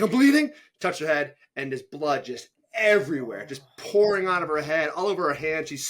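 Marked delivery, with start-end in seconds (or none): 0.0-0.5 high, loud mock alarm; alarmed realisation. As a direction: imitate an injured runner suddenly noticing blood. Injured runner's reply acted out.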